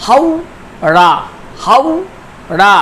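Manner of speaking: হাউ, রা split into two separate beats, repeated; syllable by syllable. Shown as a demonstration. Teacher showing a two-syllable word, syllable by syllable. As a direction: pause cleanly between হাউ and রা. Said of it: "এখানে দুটি দল আছে".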